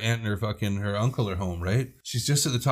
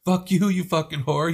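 angry; 6 semitones higher than usual. Retold or imitated. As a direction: yell the insult back. Luann yelling back.

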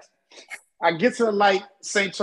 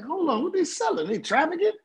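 incredulous question; 7 semitones higher, 4 dB quieter than usual. Retold or imitated. Retold surprise at street stalls.

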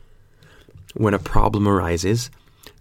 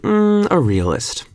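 snide; 13 semitones higher, 5 dB louder, slower than usual. Retold or imitated. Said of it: "She's like".

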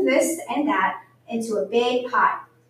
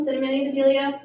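plain reading voice

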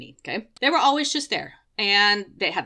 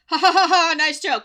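loud, higher fake ha ha ha; sarcastic mock laugh; 9 semitones higher, 8 dB louder than usual. Mocking the cursed clown in the shop, loud sarcastic laugh. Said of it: "I'm like".

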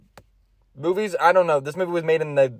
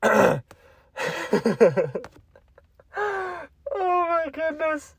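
laughing fit; 10 semitones higher, 4 dB quieter, much slower than usual. Real, not acted. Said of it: "I'm sweaty".

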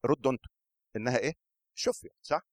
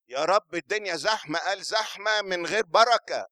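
higher, louder, slowed retort; loud emphatic retort; 8 semitones higher, 6 dB louder than usual. Acted.

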